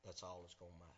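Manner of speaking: ordinary talk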